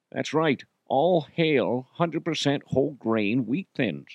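hammed-up Scottish star reading ad copy; Connery. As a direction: read it out in a slurred mock-Scottish voice. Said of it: "[name] reads random things".

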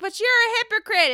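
high, loud mock accusation; Jennifer Aydin. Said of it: "then she's like".

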